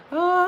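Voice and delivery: high, loud sung wail in [name]'s style; [name]'s riff. Pretend. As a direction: belt it like [name]. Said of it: "I like [name]'s as well".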